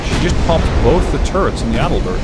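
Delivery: shocked loud outburst. Real, not acted. Turrets destroyed mid-battle.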